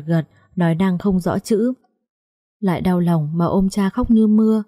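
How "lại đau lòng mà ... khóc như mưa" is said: plain reading voice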